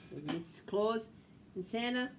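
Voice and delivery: plain reading voice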